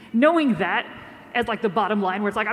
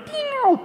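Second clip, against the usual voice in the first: high "pew" like a falling shell; shell whistle noise; 13 semitones higher than usual. Retold or imitated. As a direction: mimic an artillery shell. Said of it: "a little noise like a World War One shell might make".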